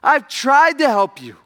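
slow, high, loud preaching yell; yelling at the top of his lungs. Retold or imitated. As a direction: belt it out slowly, pleading and furious. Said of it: "yelling at them at the top of his lungs".

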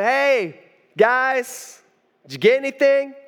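called out high across the water; calling out. Retold or imitated. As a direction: hail them from the shore. Stranger calling from the shore.

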